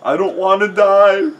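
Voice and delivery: high, loud panicked shriek; hammed-up terrified cry; Riley. A narrator's reading. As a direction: shriek it in mock terror. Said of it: "Riley shrieked".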